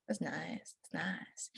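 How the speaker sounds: soft whispered approval; whispered aside. Real, not acted. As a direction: murmur it to yourself, barely voiced. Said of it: "reacting to music volume".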